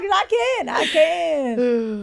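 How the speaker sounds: loud, high Western twang; Western accent. Shown as a demonstration. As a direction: show off a put-on Western accent. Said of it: "wanna talk like this whole time".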